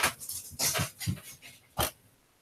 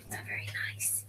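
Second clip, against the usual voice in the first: whisper; 16 dB quieter, faster than usual. Real, not acted. Whispered scolding aside.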